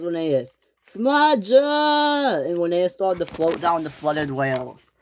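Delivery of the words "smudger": high drawn-out cry for help; calling out. A narrator's reading. Linnaeus calling to Smudger as he floats away. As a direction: call his friend from the water.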